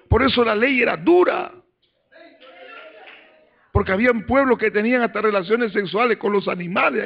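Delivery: high-pitched, urgent sermon delivery; fired-up preaching. Acted. Preacher explaining to the church why the law was harsh, fired-up preaching. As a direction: drive the explanation home from the pulpit.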